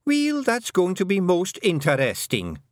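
scornful Fionn. A narrator's reading.

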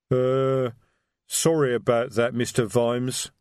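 slow, rumbling troll voice. A narrator's reading. Detritus troll voice.

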